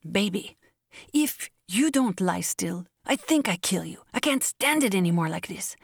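lightly voiced exasperated woman; mildly acted scolding at the end of patience; exasperated Anna. A narrator's reading. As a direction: scold the puppy in a slightly put-on voice. Maddened Anna scolds her puppy.

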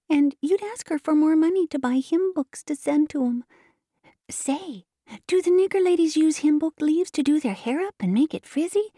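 put-on high, artless child's voice; chatty little girl. A narrator's reading.

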